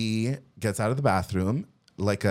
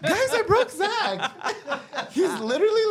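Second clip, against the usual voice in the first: laughing. Real, not acted. Laughing at friend's reaction.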